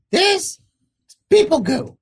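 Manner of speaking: high little creature voice; squeaky creature voice. Acted. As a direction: squeak it out proudly. GM voices creature naming potion.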